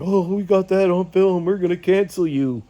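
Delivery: lightly voiced sneering troll; mocking the trolls. Father mimicking the trolls who filmed him, mock taunt. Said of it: "then they're like".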